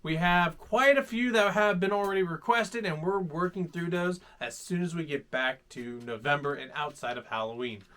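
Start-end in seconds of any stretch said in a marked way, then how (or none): none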